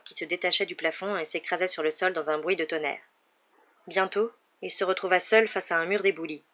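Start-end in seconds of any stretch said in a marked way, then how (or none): none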